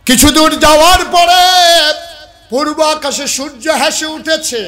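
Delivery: loud, high pulpit narration; soaring sermon storytelling; full-force preaching. Real, not acted. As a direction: ring it out to the crowd, easing at the end.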